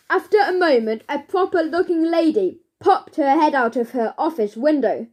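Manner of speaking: plain reading voice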